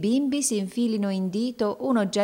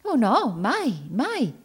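high, emphatic protest; with conviction; 6 semitones higher, much slower than usual. A narrator's reading. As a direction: insist hard that it is never so. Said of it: "essa rispose con convinzione".

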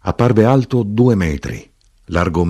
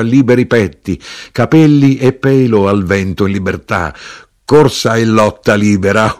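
high, loud toasting chant; resumed shouted litany; 6 semitones higher, 6 dB louder than usual. A narrator's reading. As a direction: proclaim each item exultantly. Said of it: "l'arrotino continuò".

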